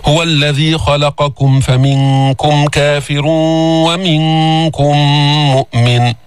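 slow measured tajweed chant; Quran recitation. Shown as a demonstration. Teacher recites the verse to students, slow tajweed chant. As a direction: recite the verse slowly for the class.

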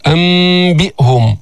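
"أنبئهم" slowly, n turned into a long hummed m; iqlab example. Shown as a demonstration. Teacher giving another example, "أنبئهم" with long hummed m. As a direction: hold the nasal: ammm-bi'hum. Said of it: "مثال آخر".

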